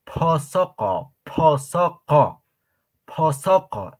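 posoko stretched out slowly, syllable by syllable; slow modelling. Shown as a demonstration. Teacher slowly modelling posoko for the class. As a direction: draw posoko out slowly for learners. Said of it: "Ahora, posoko".

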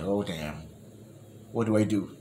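low, hushed aside to himself; retold dismay. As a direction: mutter it low, as if thinking. Retelling own nervous inner reaction.

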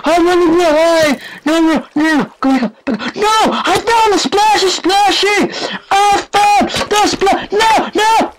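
very high squealing panic; panicked. Real, not acted. Gamer running from the splashy thing, squealing in panic. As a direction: squeal it out while fleeing. Flees what made the splashing.

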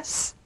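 스 as a soft hiss with no vowel; just hiss the 스, quietly; voiceless S. Shown as a demonstration. Teacher isolates the sound for students, voiceless hiss. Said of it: "둘 다 스로 발음합니다".

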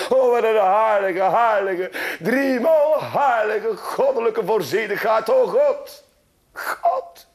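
Sint-Anna praising God in exalted rapture; ecstatic prayer. Acted.

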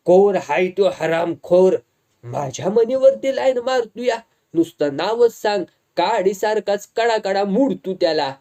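loud enraged demand, grabbing by the collar; furious threat. Acted. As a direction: roar it out, ready to break him. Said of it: "त्याची गचुंडी पकडत तो बोलला".

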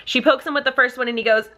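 normal talking voice

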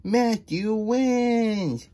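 high, drawn-out announcement; yelled cheer. A narrator's reading. Judges proclaim the winner, high drawn-out yell. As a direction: cry it out high, stretching each word. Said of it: "they yelled".

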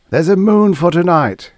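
high, loud, jubilant yell. A narrator's reading. Guthrie hails his new moon in the sky, yelling. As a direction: whoop it out to the group. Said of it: "he yelled".

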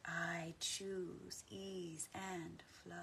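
slow quiet affirmation. Acted. Repeated affirmation.

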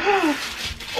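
sharp excited gasp. Real, not acted. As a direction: gasp in delight. Gasp at gift.

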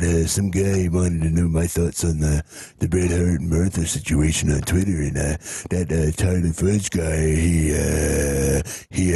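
rambling old wrestling patriarch impression; hammed-up old-timer holding forth from heaven; Stu Hart. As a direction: put on a halting character voice, full of uhs. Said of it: "Stu Hart".

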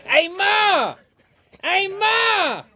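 high, loud Boston holler; Boston accent. Shown as a demonstration. Guest demonstrates how Boston sounds, yelling in the accent. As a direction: holler long across the house in a Boston accent. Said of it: "if you go Boston, they're like".

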